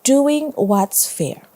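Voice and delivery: ordinary delivery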